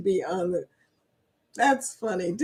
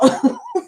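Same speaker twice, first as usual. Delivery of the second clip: squeal of laughter. Real, not acted. Laughing at chat joke.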